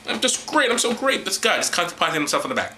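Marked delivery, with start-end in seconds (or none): 0.1-1.2 lightly voiced smug God, a bit higher; mock vain God. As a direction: preen like a vain deity. Mock God self-praise.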